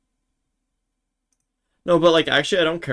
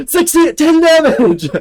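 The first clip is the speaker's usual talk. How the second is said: loud, much higher, slow triumphant yell; ecstatic shout; 12 semitones higher, 12 dB louder than usual. Real, not acted. Streamer shouting his score at the game, ecstatic shout. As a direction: scream the number out in joy. Big hit in the duel.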